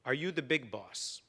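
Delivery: a hint of an Italian man's voice, low; Italian accent. Italian man asks the new store owner, light Italian accent. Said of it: "in a thick Italian accent".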